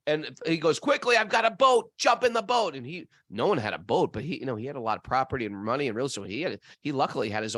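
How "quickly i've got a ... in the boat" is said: loud, higher-pitched retold urgent order; urgent shouting. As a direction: act out a father rushing his family. Father urging family in flood.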